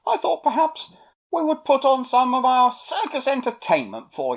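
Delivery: high, loud, chatty monkey; excitable little monkey making an offer; Millie the monkey, eager. A narrator's reading. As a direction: pitch your circus show proudly to the crowd. Monkey offers circus show.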